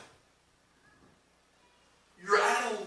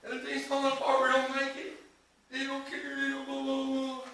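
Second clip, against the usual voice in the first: higher, louder whiny complaining voice; mock despair; 8 semitones higher, 7 dB louder than usual. Preacher voicing a troubled church member, whiny. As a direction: ham up someone moaning that life is falling apart. Acting out a struggling person.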